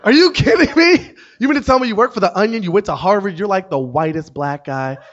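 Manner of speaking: high, loud disbelief tumbling into a rush; mock astonished excitement. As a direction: imitate a stranger flabbergasted and thrilled. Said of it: "She was excited".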